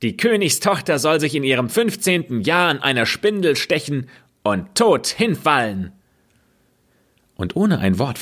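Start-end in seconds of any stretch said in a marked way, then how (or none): 0.0-5.9 high, rushed wicked fairy curse; loud voice. A narrator's reading. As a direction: proclaim the curse to the whole hall. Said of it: "rief sie mit lauter Stimme".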